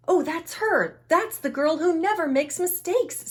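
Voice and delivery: high, whispered-in-awe onlooker; a lightly voiced admiring lady; gossiping woman voice. A narrator's reading. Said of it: "said a woman".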